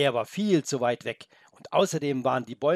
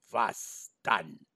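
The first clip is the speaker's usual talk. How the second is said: low growling wolf; growled question; 4 semitones lower than usual. Acted. The wolf growls at the boy, low. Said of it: "knurrte der Wolf".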